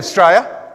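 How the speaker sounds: "Australia" squashed to "Straya"; slurred Aussie. Shown as a demonstration. Lecturer imitating Australians answering where they live, slurred. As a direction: drop the first syllable of "Australia", say "Straya". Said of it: "pretty much what Australians say".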